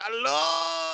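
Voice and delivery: high shouted hello; Tuco shout. Retold or imitated. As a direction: yell it like Tuco. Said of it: "He's like".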